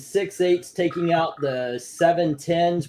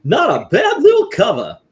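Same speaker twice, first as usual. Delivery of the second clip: loud, high Mad Hatter voice; high-pitched, booming Mad Hatter; Mad Hatter impersonation; 8 semitones higher, 9 dB louder than usual. Acted. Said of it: "my Mad Hatter impersonation".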